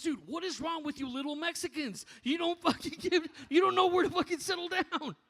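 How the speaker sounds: high, loud, building hammed-up rant; mock exasperated yell. Kent ranting at the speaker afterwards, hammed-up exasperated yell. Kent's incredulous rant retold.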